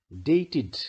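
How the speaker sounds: "dated" in two syllables, "day-tid". Shown as a demonstration. Teacher gives the next example, -ed pronounced "id". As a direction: model "dated" with a clear "id" ending. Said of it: "क्योंकि इसमें भी ट sound है".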